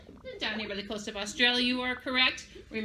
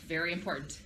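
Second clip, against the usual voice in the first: ordinary talk, nothing marked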